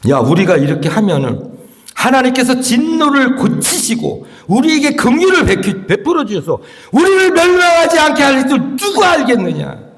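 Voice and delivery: high-pitched king's appeal. Acted. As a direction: rise into an impassioned plea to the people. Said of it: "이 왕이 뭐라고 말하냐면". (점점 높아지는 왕의 간곡한 호소)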